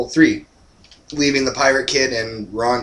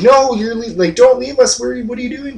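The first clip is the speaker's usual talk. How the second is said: much higher, pleading voice; kids pleading; 12 semitones higher, 4 dB louder than usual. Retold or imitated. Kids begging Rhonda in the elevator not to leave, high pleading. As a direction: beg her not to leave you behind. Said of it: "they're like".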